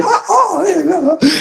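very high mock wail; imitated wailing. Woman reacting to prophecy, imitated high wailing. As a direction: cry out high, imitating someone overcome.

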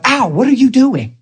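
high, swooping surprised question; retold squeal of surprise; astonished greeting. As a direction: act out amazement, pitch way up. Acts out surprised meeting with coworker.

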